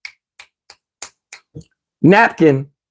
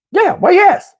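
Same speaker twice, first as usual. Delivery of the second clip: high mock woman; 11 semitones higher, slower than usual. Date's reply, voiced by him.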